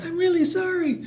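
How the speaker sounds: high, soft little voice; baby dinosaur. A narrator's reading. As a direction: apologise as a timid baby dinosaur. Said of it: "And he said".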